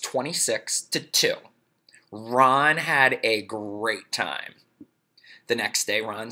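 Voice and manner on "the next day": ordinary delivery, nothing marked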